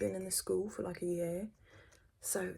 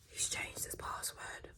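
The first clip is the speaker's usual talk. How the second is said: dramatic whisper; 5 dB quieter, slower than usual. Retold or imitated.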